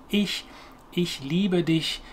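whispery front-of-mouth ch in "ich" and "dich"; soft German ch. Shown as a demonstration. Teacher showing learners the soft C H sound, soft German ch. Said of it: "very soft, gentle sounding".

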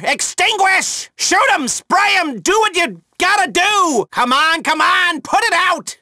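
rally the crew over the noise; shouting orders. Acted. Handler yelling firefighting orders at his team, urgent shout.

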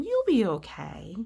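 ordinary delivery, nothing marked

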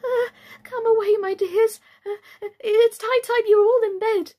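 high, loud, quavering mother bird; trembling voice. A narrator's reading. Canary calling its children away, in a trembling voice. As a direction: call out tremulously, stammering between phrases. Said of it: "called out in a trembling voice".

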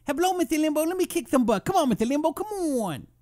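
very high, put-on cartoonish voice; comedy character voice. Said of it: "I used to be Rush Limbaugh on the show".

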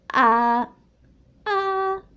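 long held "A", open vowel; saying ah. Acted. Boy shows dentist his throat, saying ah. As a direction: sustain "A" like a patient at an exam. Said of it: "Tienes que decir la primera vocal".

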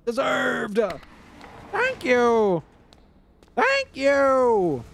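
a funny character voice thanking; put-on voice. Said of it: "if I talked like that".

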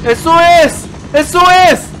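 high, loud mock cry for help; calling for help. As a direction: yell for the helicopter to come. Calling helicopter for rescue.

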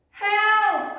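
high, loud cry of help; screaming for help. A narrator's reading. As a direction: scream it out in fright. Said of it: "cried, screamed Goldilocks".

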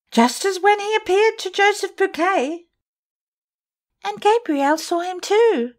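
put-on high young girls' voices; frightened girls. The girls tell Sorelli of the ghost, high and scared. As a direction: tell it breathless with fear.

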